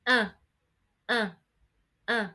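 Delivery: "uh" as short nasal French in; nasal vowel. Shown as a demonstration. Teacher demonstrating to learners, "uh" as an isolated nasal vowel. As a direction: repeat "uh" through the nose, separate and short. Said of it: "produce a nasal vowel sound".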